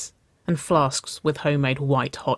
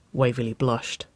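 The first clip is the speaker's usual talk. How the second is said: usual speaking voice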